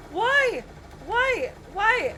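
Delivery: high, loud desperate cries; repeated frantic yells; panicked yelling. Real, not acted. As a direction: shout it out in disbelief. Panicking mid game fight.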